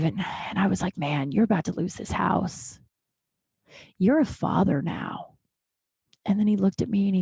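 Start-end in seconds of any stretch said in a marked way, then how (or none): 1.0-5.3 hushed, solemn self-talk in dad's voice, slowing at the end; dead serious. Retold or imitated. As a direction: imitate dad's grave realization, softer and weighty. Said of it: "he was so serious".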